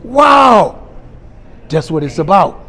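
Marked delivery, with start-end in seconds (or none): 0.0-0.9 loud, high drawn-out wow; amazed. Retold or imitated. Checker marvels at Mister T's clean record, amazed. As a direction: let the wow burst out in showy amazement.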